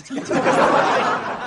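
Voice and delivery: short unvoiced laugh at the joke; breathy laugh. Real, not acted.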